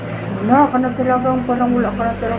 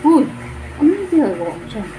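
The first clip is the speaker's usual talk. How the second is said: ordinary delivery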